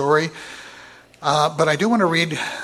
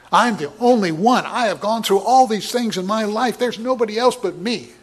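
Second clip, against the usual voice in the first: self-pitying Elijah; 7 semitones higher, 6 dB louder than usual. Retold or imitated. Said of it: "He was out of control over this depression".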